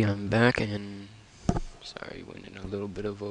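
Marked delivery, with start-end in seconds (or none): 1.8-3.3 whisper. Real, not acted. Back from break, whispering.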